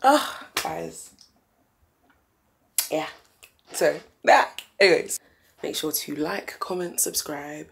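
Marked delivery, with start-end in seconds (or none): none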